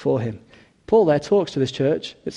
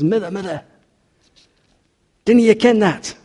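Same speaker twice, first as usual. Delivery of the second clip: raised, urgent Scottish plea; Scottish accent; 6 semitones higher, 4 dB louder than usual. Acted. Son shows his scar to his mother, Scottish accent. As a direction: call to your mother in high Scottish. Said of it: "in the Scottish accent".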